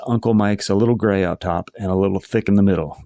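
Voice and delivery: ordinary delivery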